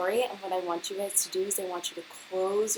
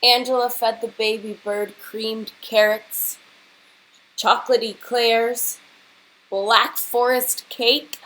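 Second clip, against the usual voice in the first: louder, relishing list of treats; lively story reading; 8 dB louder than usual. A narrator's reading. Teacher reading the indulgent menu, relishing it. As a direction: savour each rich food for the kids.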